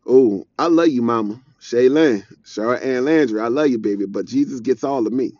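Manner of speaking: usual speaking voice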